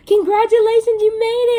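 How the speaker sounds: high, loud, thrilled shout; announcing she passed, cheering high and loud; excited congratulations. Retold or imitated. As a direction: burst out with the good news, high and loud. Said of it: "she's like".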